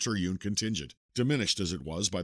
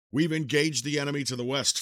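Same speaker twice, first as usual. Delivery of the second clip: a raised, upright military report; lightly voiced young officer reporting in; brisk soldier's report; 6 semitones higher, 5 dB louder than usual. Cavalry leader reports to colonel.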